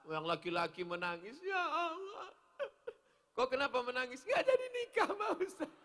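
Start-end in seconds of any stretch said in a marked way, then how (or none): 1.4-5.7 mock sobbing. Young man sobbing to ustadz.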